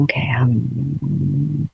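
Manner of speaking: very slow, soft whispered cue; a hushed whisper before humming. Shown as a demonstration. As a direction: breathe the words out barely voiced. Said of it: "Let's do a hum".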